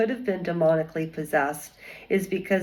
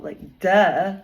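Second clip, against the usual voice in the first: snide, dismissive duh; sarcastic eye-roll duh; mocking duh; 3 dB louder than usual. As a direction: toss off a mock duh with a rising sneer. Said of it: "That's the way she was looking at people".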